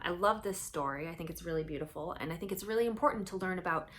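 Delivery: ordinary delivery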